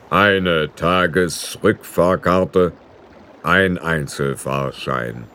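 very slow, drawn-out boatman; a weary otherworldly ferryman. A narrator's reading. Ferryman demanding the fare from Roland, very slow and drawn out.

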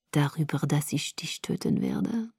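soft, low, whispery seduction; seductive purr. A narrator's reading. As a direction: murmur the threat like a sweet nothing. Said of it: "säuselte er verführerisch". (verführerisch leise gesäuselt)

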